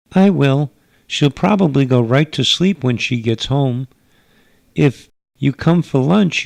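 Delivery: a gracious hostess from another world; alien mother. Acted.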